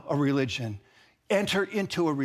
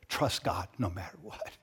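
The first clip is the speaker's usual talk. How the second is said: soft low refrain; 8 semitones lower, 6 dB quieter than usual. Real, not acted. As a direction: lower and softer, gently rising at the end. Refrain repeated softly.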